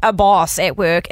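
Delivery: ordinary talk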